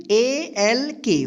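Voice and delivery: each letter spelled slowly; slow spelling. Shown as a demonstration. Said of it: "वॉक, ठीक।". (धीरे-धीरे अक्षर)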